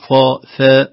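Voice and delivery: first "فا" dark, second "فا" thin; heavy then light fā. Shown as a demonstration. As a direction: contrast "فا" thick, then "فا" fronted. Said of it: "ونرقق". («فا» مفخمة ثم مرققة)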